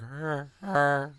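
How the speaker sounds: quiet, worn-out grunting; exhausted monster grunt. Retold or imitated. The monster lying down, spent, grunting softly. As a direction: grunt like a beast too tired to fight. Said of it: "He's exhausted".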